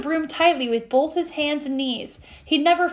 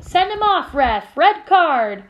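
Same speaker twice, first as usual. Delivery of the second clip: raised, indignant fan's shout; yelling; 4 semitones higher, 5 dB louder, slower than usual. A narrator's reading. Dean demands a penalty from the ref, yelling. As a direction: yell at the referee from the stands. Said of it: "Dean Thomas was yelling".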